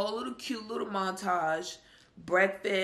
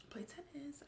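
whisper; 8 semitones higher, 14 dB quieter, slower than usual. Real, not acted. Teasing the plan in a whisper.

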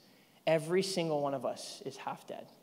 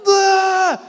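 loud, high startled scream; sudden high yell of panic; panicked scream; 16 dB louder than usual. Retold or imitated. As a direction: scream like something is crawling on you. Acting out panic at the frog.

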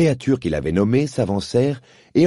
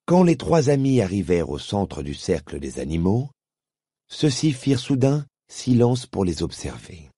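ordinary delivery

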